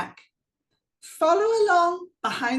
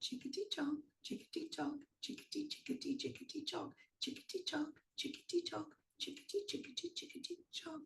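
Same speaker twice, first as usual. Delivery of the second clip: softly whispered chant; unvoiced tiptoe whisper; 2 semitones higher, 17 dB quieter, faster than usual. Shown as a demonstration. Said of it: "Can you go quietly?".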